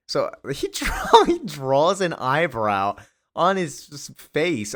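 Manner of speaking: high, stretched words through laughter; laughing while talking. Real, not acted. Podcaster cracking up over Lex's eyebrow, laughing as he talks. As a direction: giggle your way through the sentence. Amused by comic's silly scene.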